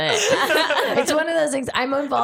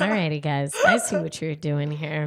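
lower, smooth radio host voice; 6 semitones lower than usual. Shown as a demonstration. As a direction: slide into a smooth announcer voice. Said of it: "Get your smooth voice on".

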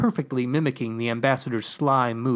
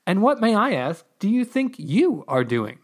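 pitched-up, pointed retort; demanding; 7 semitones higher than usual. A narrator's reading. Digger answering the voice from above, demanding. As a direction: throw the question back with emphasis. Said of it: "I demanded".

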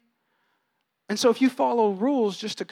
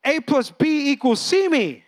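slow, higher, spelled out A plus B equals C; mocking formula; 7 semitones higher, 4 dB louder, slower than usual. Preacher mocks a rule-keeper's formula, slow and high. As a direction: lay the formula out slowly, mocking it.

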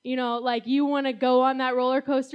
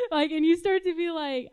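usual speaking voice

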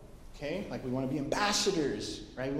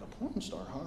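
softer, hushed aside to her husband; retold whisper; 3 semitones lower, 8 dB quieter than usual. Wife murmuring to husband by the fire, softer hushed aside. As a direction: lean in and say it under your breath. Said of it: "I whisper in Blaine's ear".

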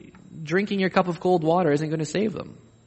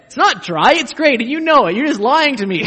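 higher, louder, laughing protest; laughing mock insistence; 9 semitones higher, 8 dB louder than usual. Preacher plays a guest insisting the meal is great, laughing.

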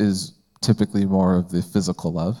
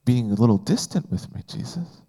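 hurt Peter; 5 semitones higher, 4 dB quieter than usual. Retold or imitated. Peter pleads with Jesus, hurt he is asked again, high and hushed. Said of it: "That was, like, his response".

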